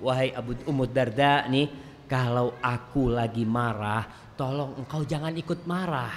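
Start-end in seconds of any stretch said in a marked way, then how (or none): none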